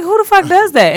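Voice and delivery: high-pitched incredulous annoyance, retold; irritated. Retelling her reaction to a rude coworker, irritated. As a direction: exclaim it in exasperated disbelief. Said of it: "I was just irritated".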